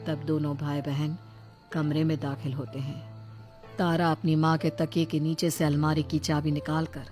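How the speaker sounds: ordinary delivery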